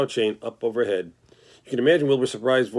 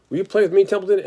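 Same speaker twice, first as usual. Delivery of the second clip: much higher and louder hopeful pig; 8 semitones higher, 5 dB louder than usual. Acted. The pig asks the rat to play, high and eager. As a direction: ask eagerly, voice way up.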